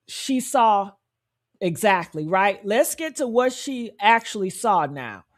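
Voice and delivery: ordinary talk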